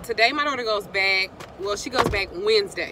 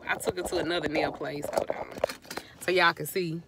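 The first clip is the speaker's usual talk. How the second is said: ordinary delivery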